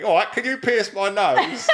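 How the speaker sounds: a jokey hint of Cockney, grinning through it; Cockney accent. Retelling a nose piercing request in a playful Cockney accent. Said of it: "I did it in a Cockney".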